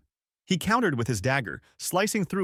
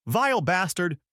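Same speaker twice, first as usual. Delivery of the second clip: shouted; 8 semitones higher than usual. Acted. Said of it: "the priest shouted".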